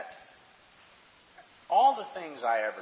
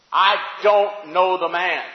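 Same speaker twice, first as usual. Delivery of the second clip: cursing denial; 7 semitones higher, 11 dB louder, slower than usual. Acted. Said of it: "he starts cursing and swearing".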